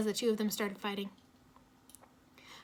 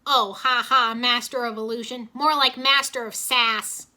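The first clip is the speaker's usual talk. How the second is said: firing back; 10 dB louder, slower than usual. A narrator's reading. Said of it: "Jay fired back".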